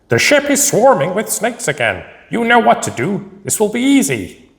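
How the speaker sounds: put-on high, far wise old master voice; calm wise martial arts master; Sensei Wu voice. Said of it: "Can I do Sensei Wu's voice?".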